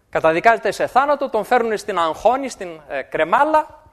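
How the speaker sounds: ordinary talk, nothing marked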